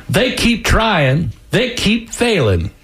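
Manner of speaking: high, very slow Southern gentleman voice; Charlie Wall. A narrator's reading. Old boss after another attempt on his life, slow drawled character voice. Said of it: "that voice of Charlie Wall".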